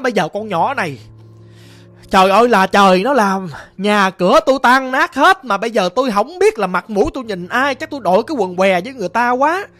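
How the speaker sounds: loud, high wailing complaint; lamenting shout. Retold or imitated. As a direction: rail at him in despair. Mother wails about pregnant daughter.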